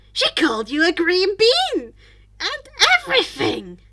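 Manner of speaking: loud and rising toward the end; teacher gleefully teasing the student in front of the class; gleeful shout. Acted. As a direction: yell it like delicious gossip. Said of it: "Mike yelled".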